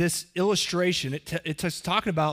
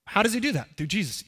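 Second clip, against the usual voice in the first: usual speaking voice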